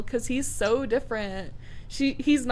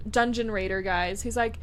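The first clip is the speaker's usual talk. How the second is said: ordinary talk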